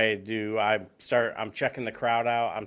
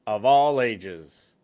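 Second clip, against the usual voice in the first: ringmaster; 6 dB louder than usual. Pretend. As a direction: stretch it out like a circus host. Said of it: "better than my intro".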